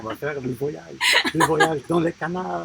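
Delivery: lightly put-on French, laughing at the end; playful French. Husband joking to wife about the canal trip, in light mock French. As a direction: keep the joke going in French.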